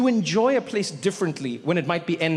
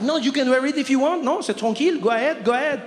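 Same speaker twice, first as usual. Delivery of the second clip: French clerk; 7 semitones higher than usual. Retold or imitated. French clerk voiced in story.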